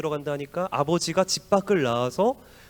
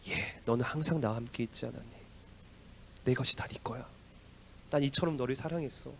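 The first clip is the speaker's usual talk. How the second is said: soft, gentle father's reply; father tenderly reassures his angry eldest son, softly; 4 semitones lower, 10 dB quieter than usual. Acted. Father's loving answer voiced.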